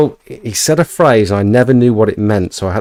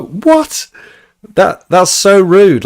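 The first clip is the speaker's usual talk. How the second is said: much higher, slow, shocked cry; outraged disbelief; 10 semitones higher, 5 dB louder, much slower than usual. Retold or imitated. To the rude old lady, higher shocked outrage. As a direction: squeak out your disbelief.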